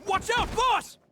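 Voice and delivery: warning shout. Acted. Warning shout during attack.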